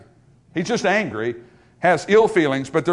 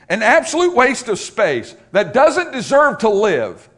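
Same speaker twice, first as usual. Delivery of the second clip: loud, slow, raised-pitch denunciation; acted all-out hatred; 5 semitones higher, 5 dB louder, slower than usual. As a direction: hammer out the insult with mock hatred. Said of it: "of all-out hatred".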